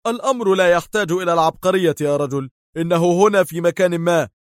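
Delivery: agitated, impatient answer; edgy, irritated. A narrator's reading. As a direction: snap back with nervous irritation. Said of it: "أجابه دار في عصبية".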